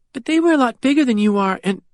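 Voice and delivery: put-on high, fast child protest; worried little girl. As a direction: blurt out the worry before he can finish. Annie objects.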